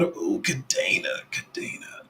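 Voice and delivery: ooh drawn out slowly, then Kadena whispered; hushed whisper. Real, not acted. Streamer reading a coin name from chat, whispered. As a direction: savour the name under your breath.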